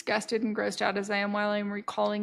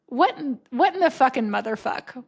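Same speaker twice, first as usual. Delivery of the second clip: louder, startled incredulous outburst; exclaimed swearing; 3 semitones higher, 5 dB louder than usual. Retold or imitated. Podcast replay as the mailman walked in, loud disbelief. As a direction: blurt it out in mock shock. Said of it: "I was saying something like".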